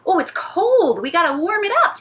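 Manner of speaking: high, animated pretend shiver at the cold; playful exclaiming. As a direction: exclaim it big for little kids. Pretending the play dough is cold.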